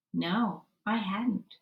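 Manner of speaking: plain reading voice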